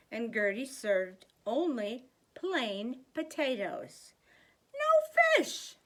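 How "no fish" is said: high, loud outburst of dismay; shocked cry. A narrator's reading. Gus seeing plain potatoes, a shocked high cry. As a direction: cry out in disbelief at dinner.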